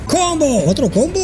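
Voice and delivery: high hyped combo call; fighting game announcer. Acted. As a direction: shout it like an announcer.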